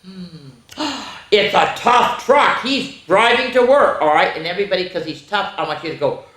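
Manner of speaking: usual speaking voice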